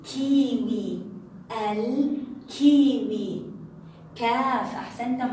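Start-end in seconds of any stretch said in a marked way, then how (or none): 0.0-1.0 "الكيوي" slow, with a clearly held ل; pronounced lam. Shown as a demonstration. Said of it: "إنها لام قمرية". 1.7-3.4 "الكيوي" very slow and loud, ل held; pronounced lam. Shown as a demonstration. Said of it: "إنها لام قمرية".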